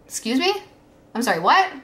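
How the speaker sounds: high, rising, incredulous mom; imitated shocked disbelief. Mom hearing her daughter has been hurt, shocked. Mom reacting to disclosure, acted out.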